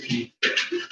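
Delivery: quick unvoiced whisper, as a secret; "She eats cat food" whispered under the breath; whispered aside. Real, not acted. Whispered aside then repeated.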